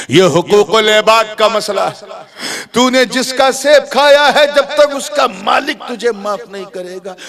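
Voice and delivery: high, forceful verdict; inner voice of conscience. Acted. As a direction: pronounce it like an accusing conscience. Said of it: "अंदर से आवाज आई उसके मन से". (अंतरात्मा की ऊँची फटकार)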